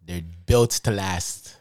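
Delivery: slow, low slogan read; mock truck advert. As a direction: land the tagline like an ad announcer, drawn out.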